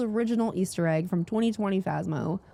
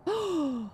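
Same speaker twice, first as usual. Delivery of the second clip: high sudden intake of breath; amazed gasp; 8 semitones higher, 4 dB quieter than usual. Real, not acted. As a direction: gasp at a thrilling discovery. Spots an item in the store.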